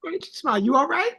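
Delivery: high, fast, bright-voiced greeting to a stranger; high, quick and friendly hello to a glum stranger; cheery greeting. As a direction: pitch it way up like a cheerful passerby.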